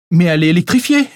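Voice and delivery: loud, high alarmed exclamation; exclaiming. Acted. Pauline cries out that the fence is electrified, alarmed. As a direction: blurt it out in sudden alarm. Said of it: "s'exclama Pauline".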